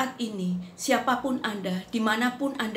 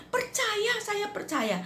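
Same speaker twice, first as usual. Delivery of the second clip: much higher, thin voice of a sick man; frail man's answer; 13 semitones higher, slower than usual. Retold or imitated. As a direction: answer like a weak dying man. Dying friend affirms faith.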